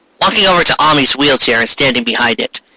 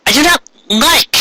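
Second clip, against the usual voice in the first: Molly voice; 9 semitones higher than usual. Acted. Voicing Molly.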